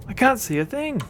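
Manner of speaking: high-pitched frustrated cry; yelling. Acted. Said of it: "[name] yells".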